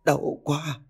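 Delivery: high, weakened voice in pain; small, pained utterance; faint stammer. A narrator's reading. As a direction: falter slightly as if hurting. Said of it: "miệng cô lắp bắp".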